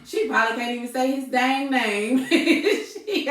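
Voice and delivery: loud, high laughter through the words; laughing. Real, not acted. Woman retelling a funny comment, laughing loudly. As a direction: crack up while retelling.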